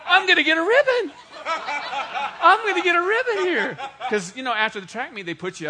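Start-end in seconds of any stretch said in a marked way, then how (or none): 0.1-3.8 much higher, louder, laughing glee; giddy kid sure he's winning a ribbon; excited inner voice. Retold or imitated. Preacher voicing his teenage self, giddy about a ribbon. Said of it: "And I'm thinking".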